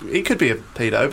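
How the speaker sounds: usual speaking voice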